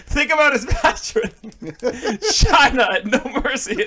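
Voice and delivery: slow, laughing through the words; laughing at his own joke pick. Real, not acted. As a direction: giggle while defending the pick.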